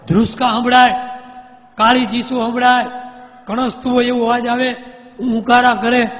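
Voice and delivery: rising, loud storyteller's build-up; dramatic suspense. A narrator's reading. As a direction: paint the midnight horror, high and loud. Narrating eerie midnight wails.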